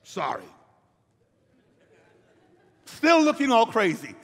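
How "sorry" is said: low, sullen mumbled sorry; grudging sorry. Retold or imitated. Grandson made to apologise to his brother, low sullen mumble. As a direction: force it out like a sulking boy. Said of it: "here's what he does".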